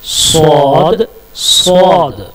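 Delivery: सौद drawn out very slowly with a heavy, thick s; slow letter demo. Shown as a demonstration. Teacher slowly drawing out the letter for students. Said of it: "ये जो letter है".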